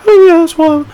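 hammed-up compliant little voice; mock polite and calm. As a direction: squeak a meekly agreeable reply. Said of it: "very polite and very calm".

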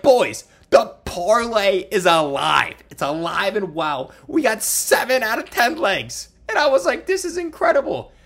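thrilled retelling of a comeback; excited. Retold or imitated. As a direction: tell the boys the big news. Announcing parlay still alive.

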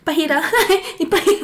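high, loud giggly answer; laughing guess. Real, not acted. Guessing the Tagalog word for share, laughing and loud. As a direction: blurt it out through laughter. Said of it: "Ano yung share sa Tagalog?".

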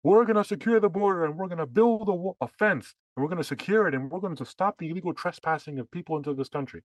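imitating Democrats railing at a rally; mock politician speech. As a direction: parody a convention speech. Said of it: "They were saying".

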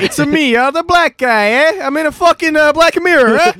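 loud, high cartoon Italian accent; mock Italian. Friends riff on the other roommate, loud mock Italian accent. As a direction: yell it like a hammy wiseguy.

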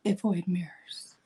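hushed, unvoiced aside; whispered confession. Real, not acted. Confessing gym mirror avoidance.

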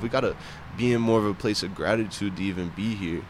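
ordinary talk

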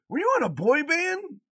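high, loud teasing question; daughter's voice. Retold or imitated. Dad mimics daughter asking about a boy band, high and loud. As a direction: mimic a daughter gasping in disbelief. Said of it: "she goes".

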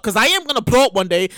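ordinary delivery

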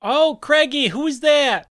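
high, cooing auntie voice; Aunt Susan. Acted. Aunt asking nephew who is calling, cooing. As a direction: coo it in a put-on high voice.

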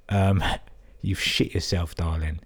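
normal talking voice

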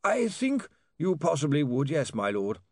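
high voice sinking in resignation; mournful reluctant advice; gloomy lawyer. Acted. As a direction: concede gloomily, dropping as you go. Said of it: "sinking further in gloom".

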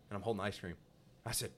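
normal talking voice

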